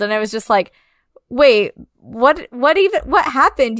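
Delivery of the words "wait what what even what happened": higher-pitched, stumbling confusion; baffled disbelief. Retold or imitated. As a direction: act out a bewildered double take. Acting out own baffled reaction.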